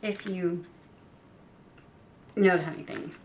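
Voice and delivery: talking through hiccups. Real, not acted. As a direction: hiccup between the words. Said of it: "sorry, I got the hiccups".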